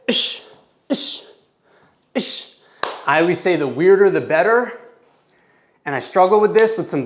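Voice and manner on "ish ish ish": short crisp "ish" exhales; sharp breaths with punches. Shown as a demonstration. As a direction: hiss out a quick ish per punch. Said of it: "My noise sounds like this".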